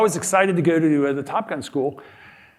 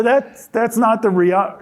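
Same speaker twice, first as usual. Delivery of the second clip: slow, louder, breaking into laughter mid-word; laughing through it; 5 semitones higher, 4 dB louder, slower than usual. Retold or imitated. To the audience about the pilot, laughing through the words. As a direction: chuckle through the quote, higher. Retelling his reaction, laughing.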